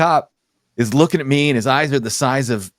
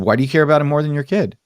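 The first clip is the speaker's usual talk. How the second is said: retold question, quick and higher; fast, pressing repeat at a higher pitch; insistent repeated question; 4 semitones higher, faster than usual. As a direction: fire the question back, raised pitch. Repeats question after her "What?.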